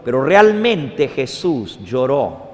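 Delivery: ordinary talk, nothing marked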